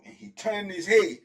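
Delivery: ordinary delivery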